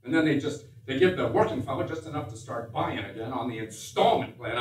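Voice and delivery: normal talking voice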